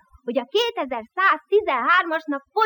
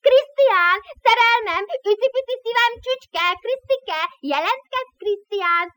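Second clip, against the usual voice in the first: mocking shouts; 7 semitones higher than usual. Prisoners jeer calling for Krisztián, high mocking yells. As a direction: yell sweet nothings to mock him. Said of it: "Össze-vissza üvöltözni kezdtek".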